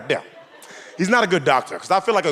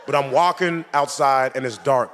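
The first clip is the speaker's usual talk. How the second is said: ordinary delivery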